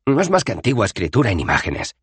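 quick, flustered explaining; hurried Rincewind. Acted. Rincewind hastily explaining the pictures. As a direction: rush the explanation. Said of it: "señaló apresuradamente Rincewind".

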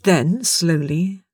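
ordinary delivery

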